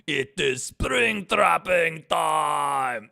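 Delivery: loud, long-drawn villain battle cry; Springtrap. Shown as a demonstration. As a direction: shout it slowly in a bad Russian accent. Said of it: "Springtrap is the exact same voice as Victor".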